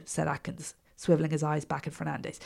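plain reading voice

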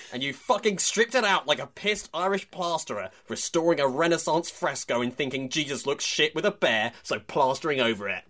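hammed-up tirade; mock furious rant. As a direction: read it like an outraged columnist. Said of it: "He says".

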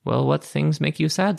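ordinary talk, nothing marked

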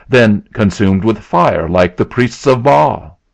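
loud, slow mocking guess; loud derisive retort; sneering. A narrator's reading. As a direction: taunt the accuser, drawing it out. Dix keeps sneering at Abner.

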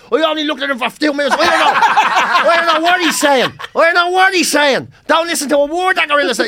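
high, loud, laughing all the way through; laughing quote. Retold or imitated. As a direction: gasp the lines out between laughs. Quoting old man amid laughter.

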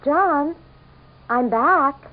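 bright call through the house to her husband. Acted. Calling out to husband.

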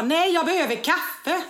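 high, loud, drawn-out outburst; exaggerated irritable shout; rude, stressed retort. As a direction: snap back loudly, acting out the stress. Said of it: "otrevlig, kort".

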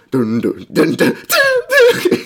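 rhythmic "dun" vocalizing leaping very high; mouthed riff climbing into a squeal; imitated drum riff. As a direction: mimic the riff, ending on high drawn-out hits. Imitating the drummer keeping on going.

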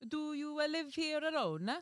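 put-on high, halting Italian-accented question; bad Italian accent. Servant questioning old woman at door, high Italian accent. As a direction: ask in a clumsy stage Italian accent. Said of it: "I'm so sorry, Italian".

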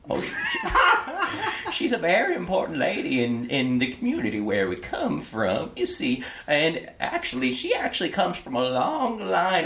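put-on high old servant voice breaking into giggles; old gentleman laughing. Servant flattered by the compliment, high and giggly. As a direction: giggle through it as the flustered old man.

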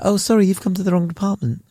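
high, quick apologetic brush-off; quick high customer-service voice; call-centre worker. Acted. Plays clueless customer service worker.

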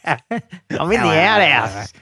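high hammed-up Australian with laughter in it; Australian woman assistant. Phone calls from the outhouse, mock Australian woman. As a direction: call out as a comic Australian woman. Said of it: "That's what mine says".

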